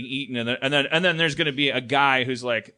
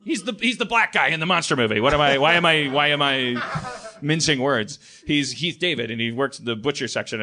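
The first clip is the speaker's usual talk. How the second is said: ordinary talk, nothing marked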